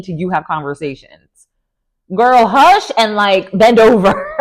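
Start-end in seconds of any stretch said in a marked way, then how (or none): 2.1-4.4 loud. Real, not acted. Telling the cast member off.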